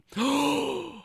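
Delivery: a big high gasp of fake shock; mock gasp. Vlogger fakes shock at the remake, high gasp. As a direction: gasp loudly as if scandalized.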